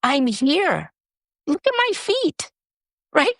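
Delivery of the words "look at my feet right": much higher, hushed coaxing to herself; urging herself to look down and feel real; self-encouragement. Retold or imitated. To herself while recovering, a much higher coaxing voice.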